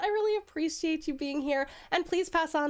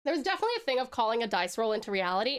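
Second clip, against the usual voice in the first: ordinary delivery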